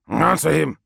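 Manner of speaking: loud furious snarl. Acted. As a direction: bark the order with fury. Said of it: "snarled Biaki".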